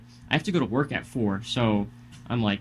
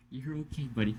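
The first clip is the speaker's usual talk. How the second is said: soothing aside; 8 dB quieter, faster than usual. Real, not acted. Reassuring Toby during the podcast, soft and soothing. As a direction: gently settle down a fretting pet.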